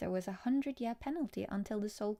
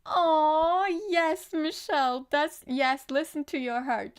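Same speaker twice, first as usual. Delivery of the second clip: excited agreement; 7 semitones higher, 9 dB louder than usual. Real, not acted. Excited answer to a viewer.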